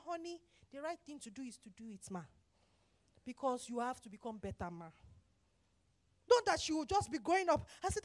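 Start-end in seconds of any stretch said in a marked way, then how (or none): 0.1-4.9 soft, low, patient tone; gentle husband teaching. Acted. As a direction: quietly coach your wife. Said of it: "sit your wife down and tell her".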